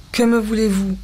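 curt, understated dryness; dry chest voice. A narrator's reading. Wife to husband she resents, dry chest voice. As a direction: ask it coolly from the chest. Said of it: "sèchement d'une voix de poitrine qu'elle ne se connaissait pas".

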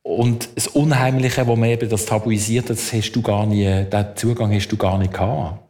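normal talking voice

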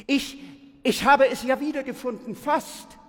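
high, loud, hurried excuse; flustered defence. A narrator's reading. Pupil defends himself to Zeus, flustered. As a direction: blurt it out high and fast after a faltering start.

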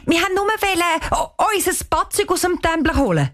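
high, hastily blurted excuse; quick excuse. A narrator's reading. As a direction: jump in with an excuse, pitched high. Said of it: "sagt Cheyenne schnell". (schnälli, höchi Usred)

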